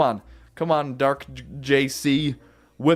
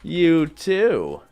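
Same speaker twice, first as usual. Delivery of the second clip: ordinary talk, nothing marked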